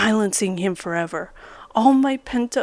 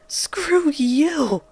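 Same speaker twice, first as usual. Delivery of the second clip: higher, sharp defiant outburst; hurled insult; 6 semitones higher than usual. A narrator's reading. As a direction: throw it at him in anger. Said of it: "I hurled at him".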